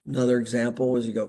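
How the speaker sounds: ordinary talk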